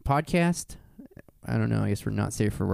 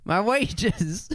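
high, drawn-out hammed-up lament; mock anguished wail; 8 semitones higher, much slower than usual. Playing along about garnished wages, mock wailing. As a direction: lament it slowly in pretend despair. Echoes co-host's mock outrage.

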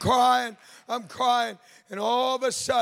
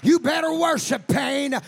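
shouted, higher sermon cry over the organ; full force preaching; 8 semitones higher, 6 dB louder than usual. Acted. Preacher urging the congregation, full force preaching. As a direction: preach it out loud, rising to a call.

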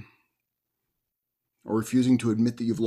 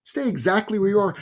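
high-pitched mock yell; retold yell; 7 semitones higher than usual. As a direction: yell a command without full volume. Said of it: "points out and yells".

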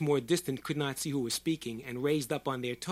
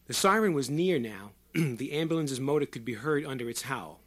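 ordinary delivery

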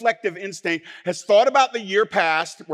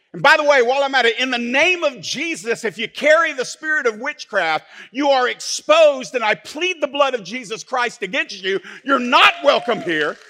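loud, high preaching rebuke; preaching at full force; 6 semitones higher, 5 dB louder than usual. Acted. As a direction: rebuke them from the pulpit, loud. Preaches against witchcraft.

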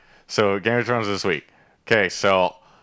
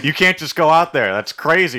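higher, louder imitation of an objection; mock alarmed protest; 9 semitones higher, 5 dB louder than usual. Adviser warning the dragon girl in a retold argument, mock alarmed. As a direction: play up the exasperated warning. Acted argument between two characters.

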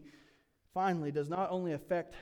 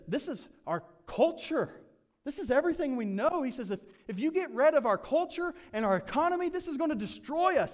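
much higher and louder, near a shout; alarmed rant; 9 semitones higher, 6 dB louder than usual. Acted. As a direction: sound the alarm that everything is lost. Demetrius warns culture destroyed.

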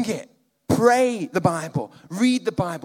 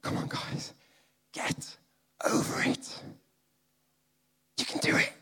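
breathy whisper, gentle coaxing; 7 dB quieter than usual. Shown as a demonstration. Preacher whispers to the congregation, coaxing them. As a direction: whisper it like a secret encouragement. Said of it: "let's just try and change my intonation".

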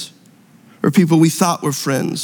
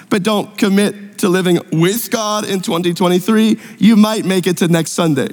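ordinary talk, nothing marked

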